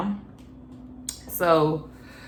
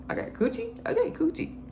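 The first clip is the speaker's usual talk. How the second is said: high playful; 7 dB quieter than usual. Retold or imitated. Mimicking saying coochie playfully.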